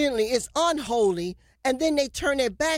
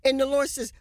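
ordinary talk

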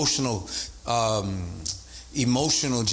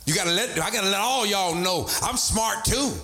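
higher-pitched self-assertion; raised voice; 7 semitones higher than usual. Acted. Team member insisting to everyone at work, raised voice. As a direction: imitate someone insisting with a raised voice. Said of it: "Your voice gets raised".

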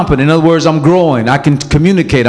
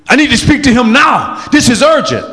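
mock pushy demand; 7 semitones higher than usual. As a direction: imitate the pushy pastor barging in. Pastor acts out aggressive visitor.

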